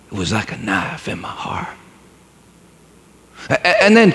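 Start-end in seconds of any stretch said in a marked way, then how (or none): 0.1-1.8 whisper. Acted. Boy's hurt inner thought.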